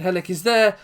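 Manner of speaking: high, loud rising call; excited shout. Acted. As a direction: shout it as the ball arrives. Header chance at corner.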